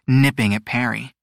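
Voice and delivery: ordinary delivery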